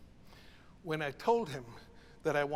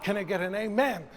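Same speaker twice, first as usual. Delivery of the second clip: call for amen; 3 semitones higher, 6 dB louder than usual. Shown as a demonstration. Preacher calls to congregation.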